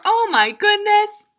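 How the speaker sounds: high, loud astonished cry; excited exclamation. Acted. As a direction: burst out in amazement. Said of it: "cowboy for".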